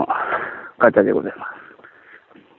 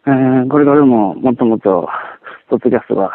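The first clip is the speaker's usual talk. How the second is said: ordinary delivery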